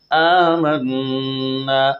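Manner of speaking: آمَنَّا sung slowly with the nasal نّ held and the final alif drawn out; stretched vowel. Shown as a demonstration. Teacher demonstrating the long alif of آمَنَّا slowly. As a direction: draw out آمَنَّا with ghunna on نّ. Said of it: "اس میں تھوڑا کھینچ کر اس کو پڑھا جائے گا".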